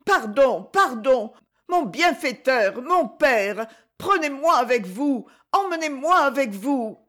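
put-on high, begging child's voice; high pleading boy, desperate; pleading cry. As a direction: beg him to take you along. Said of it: "s'écria".

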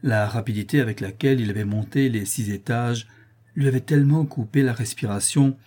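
ordinary delivery, nothing marked

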